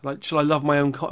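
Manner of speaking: ordinary talk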